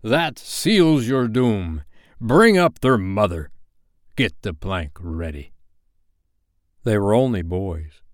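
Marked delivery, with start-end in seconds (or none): 0.0-5.5 slow pirate captain's mild roar. Acted. Hook condemning the defiant boys, slow roared orders. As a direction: pronounce doom as a roaring captain, drawn out. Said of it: "Hook roared out".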